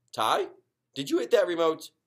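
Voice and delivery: dad calling to his son. Acted. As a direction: call over to Ty.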